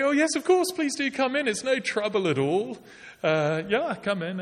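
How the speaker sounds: high, bright mock-polite welcome; forced politeness. Woken neighbour forcing a polite welcome, high and strained. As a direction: gush with strained courtesy. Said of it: "What he would actually do is say".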